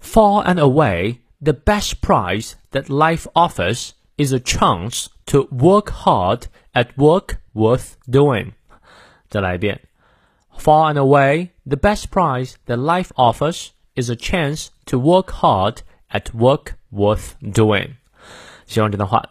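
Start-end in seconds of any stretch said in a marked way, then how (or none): 0.0-8.5 slow British accent, r's dropped; careful British model reading with a long ah in "chance"; British pronunciation. Shown as a demonstration. Teacher shows the British version to learners, British accent. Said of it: "那如果英式发音呢". 10.6-17.9 slow British accent without r's; British pronunciation. Shown as a demonstration. Teacher repeats the reading for learners, British accent. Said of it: "那如果英式发音呢".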